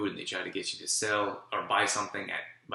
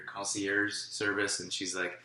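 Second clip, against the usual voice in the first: usual speaking voice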